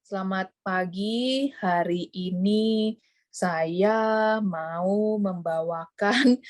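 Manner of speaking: dragging, very slow sleepy delivery; too slow. Shown as a demonstration. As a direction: drawl it so slowly the audience dozes off. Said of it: "Nah, itu orang keburu tidur ya".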